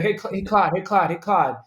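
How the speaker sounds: lightly impersonated nagging quarterback, repeating himself; Peyton. Acted. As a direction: pester the coach for attention, in light mimicry. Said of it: "Let's hear Peyton".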